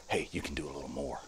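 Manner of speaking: very soft whispered encouragement; hushed voice of the body, barely audible. Acted. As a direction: whisper a gentle nudge. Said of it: "'Cause if it's whispering".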